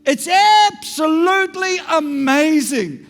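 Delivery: building to a loud, high proclamation; rising sermon climax, ringing out; impassioned preaching. Real, not acted. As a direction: proclaim it at full force, then ease down.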